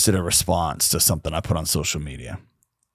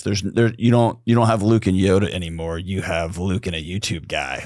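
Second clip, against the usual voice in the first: ordinary talk, nothing marked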